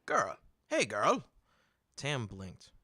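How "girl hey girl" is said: loud merchant hollering across the market; calling out. Acted. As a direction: shout to grab a passing girl's attention. Merchant calls out to Tam.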